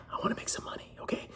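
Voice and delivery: playful whispered aside; whispering. Real, not acted. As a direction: whisper it like a secret. Whispered aside about wanting money.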